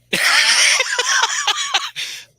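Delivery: squealing high-pitched laugh. Real, not acted. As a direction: burst into a shrill squeal of laughter. Laughs at own innuendo.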